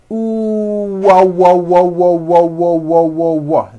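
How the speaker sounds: ooh opening into repeated wah, lips rounding then opening; W drill. Shown as a demonstration. Teacher drilling the W sound for students. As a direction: open and close the lips on each wah. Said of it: "like this".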